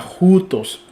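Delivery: jutos slowly, with a throaty J at the start; J sound. Shown as a demonstration. Teacher explaining rough breathing, jutos with a J. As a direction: stretch jutos, J like Spanish jota. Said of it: "formando como la, la J".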